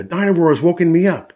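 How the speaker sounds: put-on high, loud little-girl protest; Peppa's complaint. A narrator's reading. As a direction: protest like a child just woken. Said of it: "says Peppa".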